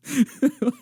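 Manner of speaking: high, helpless giggle; real laughter. Real, not acted. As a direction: crack up at your own joke. Cracks up at own joke.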